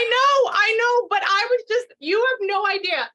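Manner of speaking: high, loud, overwhelmed with emotion; bursting with feeling; emotional. Real, not acted. Reader moved by the confirmed dog.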